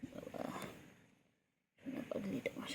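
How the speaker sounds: whispered pet talk; tender whisper. Real, not acted. Owner whispering fondly to her pet, soft whisper. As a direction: murmur it without voice, fondly.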